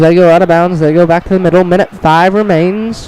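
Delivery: live wrestling call; play-by-play. Acted. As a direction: call the action like an announcer. Announcer calls the match.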